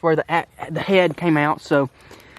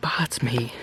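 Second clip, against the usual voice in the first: normal talking voice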